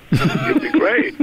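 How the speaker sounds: high, slow, laughing delivery. Real, not acted. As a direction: laugh the words out. Joking about women's prison.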